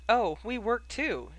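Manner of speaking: light, airy lilt, drawn out. A narrator's reading. Answering Sirius about their week, airily.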